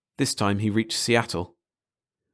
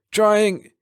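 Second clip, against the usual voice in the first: croaking cry; 11 semitones higher, 4 dB louder, much slower than usual. Acted. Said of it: "Tharn croaked".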